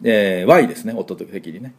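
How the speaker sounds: usual speaking voice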